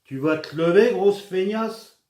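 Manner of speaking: high, loud nagging wife voice; shrill mock of a nagging wife; imitating wife. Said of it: "je vais imiter ma femme".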